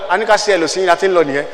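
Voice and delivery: ordinary talk, nothing marked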